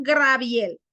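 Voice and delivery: Gabriel said as "Graviel"; R transposed in name. Shown as a demonstration. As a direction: move the r forward, "Graviel". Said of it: "no es, es Gabriel".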